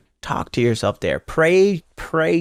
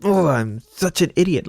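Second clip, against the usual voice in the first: higher, whiny voice beating yourself up; self-berating inner voice; 5 semitones higher than usual. Retold or imitated. Scolding yourself for not doing better, whiny self-critic. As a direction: act out the anxious critic in your head. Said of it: "you don't have to choose in the space of, like".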